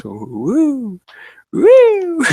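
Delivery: very high, loud celebratory woo; excited whoop. Real, not acted. Celebrating a video near a million views, loud whoop. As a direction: cheer it out at the top.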